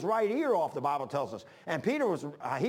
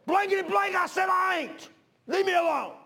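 much higher, loud furious shout; cussing shout; 13 semitones higher, 5 dB louder than usual. Retold or imitated. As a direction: yell him off, cursing. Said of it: "when he's cussing coming out of his mouth".

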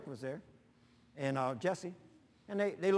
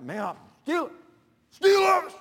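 rising into a big Steelers yell; football fan cheer; 12 semitones higher, 12 dB louder than usual. Retold or imitated. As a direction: cheer like a die-hard fan.

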